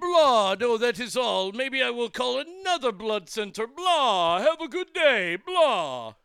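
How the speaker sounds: loud hammy vampire sign-off; Dracula voice. Acted. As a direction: ham up a vampire saying goodbye. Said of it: "The Dracula voice".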